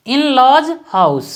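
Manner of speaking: very slow, word by word, spacing each phrase; slow dictation. Shown as a demonstration. Teacher dictating an example sentence to students, very slow. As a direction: spell it out slowly for students to copy. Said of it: "dictating the example slowly".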